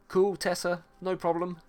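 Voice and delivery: ordinary delivery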